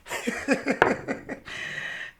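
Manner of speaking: high, soft run of giggles; real giggle. Real, not acted. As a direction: giggle helplessly at your own joke. Laughs at the letters spelled.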